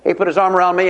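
ordinary talk, nothing marked

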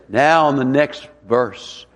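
mock slow, drawn-out announcement; slow drag. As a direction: drag it out like a dragging worship leader. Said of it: "We're singing everything real slow".

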